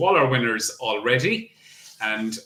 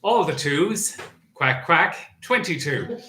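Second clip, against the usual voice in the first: bingo caller; 3 semitones higher than usual. Acted. Bingo number calling.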